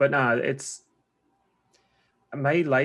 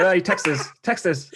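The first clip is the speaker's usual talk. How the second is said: "Texas" called out higher and louder as a puzzled echo; mock confused echo; 6 semitones higher, 6 dB louder, slower than usual. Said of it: "I was like".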